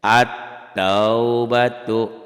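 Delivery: At-taubatu chanted very slowly and loudly, doubled t; recited Arabic example. Shown as a demonstration. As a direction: sing At-taubatu like Quran recitation. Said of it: "belajar bacanya ya". (At-taubatu dilantunkan panjang, tt ditekan)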